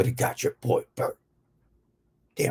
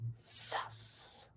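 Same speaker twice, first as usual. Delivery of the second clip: whispered one-word aside; 12 dB quieter than usual. Acted. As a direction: hiss it under the breath.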